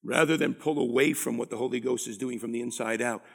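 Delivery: ordinary talk, nothing marked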